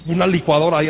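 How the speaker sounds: normal talking voice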